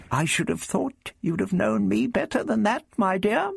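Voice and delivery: put-on high, affronted old gentleman; the Doctor, reproving. A narrator's reading. The Doctor backs up Prapillus against Barbara, reproving. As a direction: chide fondly at high pitch, lifting at the end. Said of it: "the doctor added".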